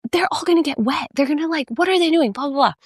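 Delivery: high worried mother rambling; mock fussing about the rain; fretting mom. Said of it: "and she's like".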